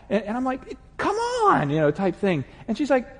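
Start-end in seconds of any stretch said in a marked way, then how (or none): 1.0-1.7 high, sharp snap; impatient husband snapping at his wife. Retold or imitated. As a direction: cut her off impatiently. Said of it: "I just kinda snap at her".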